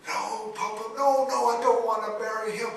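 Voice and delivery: loud, high, fast desperate protest; pleading daughter. Acted. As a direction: beg your father not to make you. Daughter pleading against marriage.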